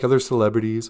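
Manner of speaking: ordinary delivery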